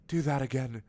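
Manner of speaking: lightly voiced pleading castle; eager castle.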